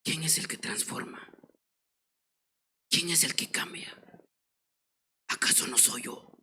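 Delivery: God whispering to the humbled preacher; whispered voice of God. Retold or imitated. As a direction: breathe the divine question in a hush. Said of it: "escucha una voz".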